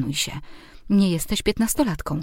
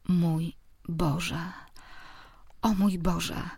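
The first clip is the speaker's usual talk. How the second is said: ordinary delivery, nothing marked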